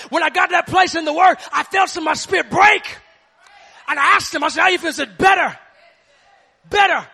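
loud, driving preacher's shout; preaching at full force. Acted. Preacher rallying the church against the devil, shouting. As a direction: hammer it home to the congregation.

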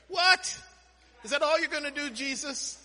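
high, loud, incredulous outburst; mock disbelief. Preacher playfully questions Jesus over the verse, mock disbelief. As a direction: balk at it, voice leaping up in disbelief.

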